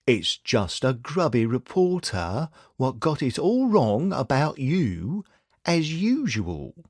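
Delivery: slow, plummy upper-class drawl, lightly done; lion comic toff putting on airs; patronising upper-class accent. Acted. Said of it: "he said in a patronising accent".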